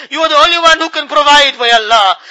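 ordinary talk

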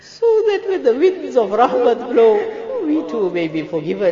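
loud, high wailing supplication; tearful plea. Real, not acted. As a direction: cry out for mercy, high and loud. Pleads for forgiveness, overcome.

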